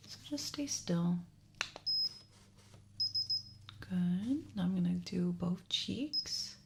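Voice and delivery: gentle doctor's voice, slipping into whispers. Acted. ASMR doctor starts ear exam.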